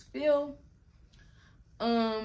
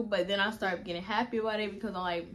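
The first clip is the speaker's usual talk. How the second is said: normal talking voice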